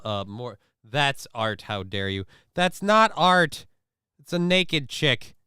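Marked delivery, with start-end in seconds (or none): 2.5-5.3 high, loud, slow protest; indignant outburst. Real, not acted. Arguing with chat about art, a loud high outburst. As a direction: spit it out, scandalised. Mock outrage about streamer.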